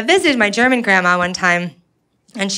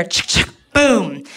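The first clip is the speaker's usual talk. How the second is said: gun salute sound; much slower than usual. Retold or imitated.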